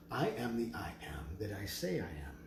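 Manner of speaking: hushed mantra; whispered affirmation. Acted. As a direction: whisper it like a sacred secret. Whispered affirmation after loud talk.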